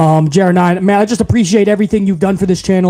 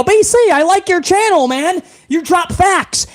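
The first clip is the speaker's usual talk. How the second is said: high, eager fanboy; hammed-up high praise from a fan; mock fan voice; 10 semitones higher than usual. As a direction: imitate a gushing new viewer. Mocking a fan's praise.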